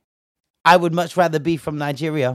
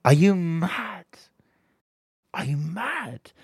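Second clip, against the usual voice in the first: slow, stunned, incredulous; exasperated disbelief; 4 semitones higher, slower than usual. Real, not acted. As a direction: ask it as if you can't believe it. Exasperated at the woman's behaviour.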